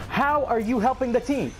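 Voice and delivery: high, exasperated challenge. Real, not acted. Player asking teammate how they help, exasperated.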